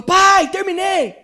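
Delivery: grito bem mais agudo e alto, arrastado e lento; grito de menino. Retold or imitated. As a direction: chama o pai de longe, como criança empolgada. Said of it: "ele gritou". (menino grita ao pai do quintal, bem agudo e lento)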